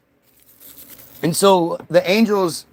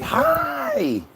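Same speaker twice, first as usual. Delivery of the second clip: squeaky high mock "Hi!"; mock scream; 5 dB quieter than usual. Imitating the pet shrieking, squeaky high "Hi!". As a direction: yelp it like a tiny pet. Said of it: "screaming at you like".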